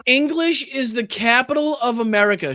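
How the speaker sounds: high, loud mocking repeat of her line; mocking quote. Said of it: "she says".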